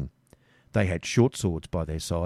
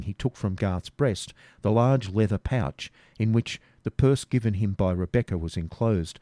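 usual speaking voice

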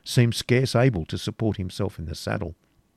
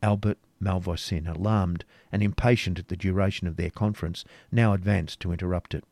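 ordinary delivery, nothing marked

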